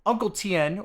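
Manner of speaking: ordinary talk, nothing marked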